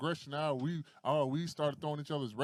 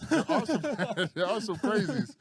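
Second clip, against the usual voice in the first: high, laughing protest; laughing insistence; 6 semitones higher, 5 dB louder than usual. Real, not acted. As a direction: push through the laugh, pitched up.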